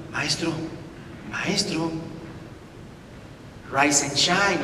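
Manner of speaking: quiet coaxing wake-up, drawn out; gently rousing a sleeping teacher; soft wake-up call. Acted. Disciples softly waking the Master in the boat.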